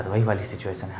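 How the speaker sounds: ordinary delivery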